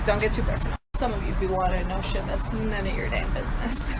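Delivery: usual speaking voice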